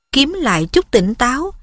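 plain reading voice

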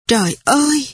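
sudden dismayed cry; blurted exclamation. A narrator's reading. Quyên realizing what happened to Mị, shocked outburst. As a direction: let it burst out in shock. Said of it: "Quyên thốt lên".

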